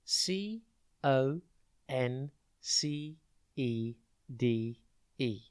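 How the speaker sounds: slow, letter-by-letter spelling. Shown as a demonstration. Teacher spelling the day's word for pupils, letter by letter. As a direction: spell it out slowly, each letter separate. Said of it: "and it's spelt".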